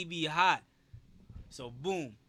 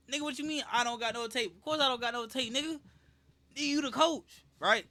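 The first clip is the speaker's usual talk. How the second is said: higher-pitched, disbelieving retort to a coach; incredulous comeback; 6 semitones higher than usual. Retold or imitated. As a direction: throw it back at him, pitched up in disbelief. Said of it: "I was kinda like that".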